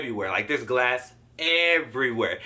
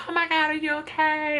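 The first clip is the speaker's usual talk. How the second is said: high-pitched imitation of worried onlookers; high, alarmed voice of a bystander; concerned bystanders. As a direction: mimic a fussing passer-by, voice way up. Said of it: "people came up and they was like".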